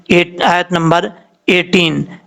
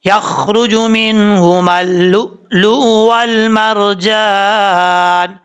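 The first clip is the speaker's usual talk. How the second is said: slow, high melodic chant; slow tajweed chant; Quran recitation; 4 semitones higher, much slower than usual. Shown as a demonstration. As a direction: recite slowly, drawn-out melody. Recites verses.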